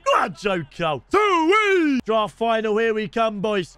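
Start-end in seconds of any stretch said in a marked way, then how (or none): 1.1-2.0 high, loud whoop; sudden high holler; celebratory whoop. Real, not acted. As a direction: whoop it out in triumph. Celebrating during live play.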